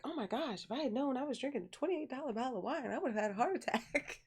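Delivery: high, rushed imitation of gushing aunt; mock gushing. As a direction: gush it high, nearly laughing by the end. Acts aunt gushing over expensive wine.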